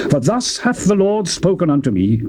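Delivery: plain reading voice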